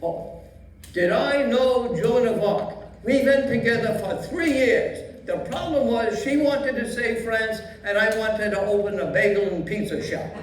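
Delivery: put-on old Yiddish grandpa accent; Yiddish old man voice.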